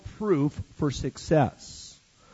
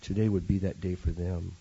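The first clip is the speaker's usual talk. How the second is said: soft, low closing prayer; hushed prayer before the sermon; 8 semitones lower, 7 dB quieter than usual. Real, not acted. Pastor praying softly over the congregation, hushed prayer.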